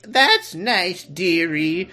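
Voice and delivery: high, put-on little old lady; grandma. Grandma answering the alien, high doting-granny voice. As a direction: pitch it up like a sweet granny. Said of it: "the Grandma Grace".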